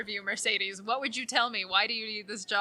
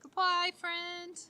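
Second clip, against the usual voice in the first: sing-song goodbye; 9 semitones higher, 3 dB quieter, much slower than usual. Acted. Sing-song farewell to departing guest.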